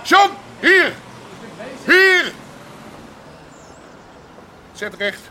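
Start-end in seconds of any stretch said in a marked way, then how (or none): 0.0-2.3 loud barked call across the street. Acted. Boss shouting for his son John to come over at once, loud call. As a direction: yell for the boy to come here.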